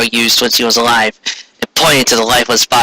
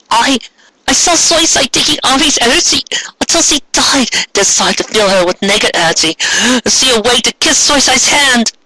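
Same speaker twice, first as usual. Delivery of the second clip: put-on much higher girl's voice, dazed; shaken Molly; 11 semitones higher than usual. Molly telling the girls what she saw, high dazed voice. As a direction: recount the vision breathlessly.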